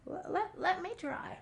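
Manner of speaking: very high, soft small-animal voice; small muskrat repeating his plea; tiny little voice. Acted. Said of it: "he said it again".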